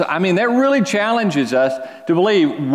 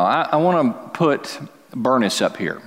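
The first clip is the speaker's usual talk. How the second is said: ordinary talk, nothing marked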